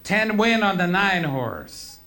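high, loud holler with a long falling end. Acted. Bettor hollering at the betting window, hollered. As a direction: holler it out like a last-second bet. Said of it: "and hollered".

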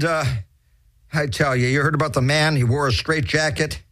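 ordinary delivery

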